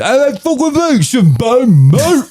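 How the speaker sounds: high, thick Cockney bloke voice; dumb British accent. Retold or imitated. Mocking a rough Cockney bloke, pitched high. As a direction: ham up a rough London geezer. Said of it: "someone who's a high society British person and someone who".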